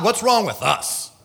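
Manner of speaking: ordinary delivery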